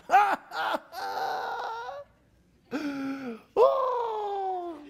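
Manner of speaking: high, loud laughing burst; real laughter. Real, not acted. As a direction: crack up loudly. Preacher laughing at memory.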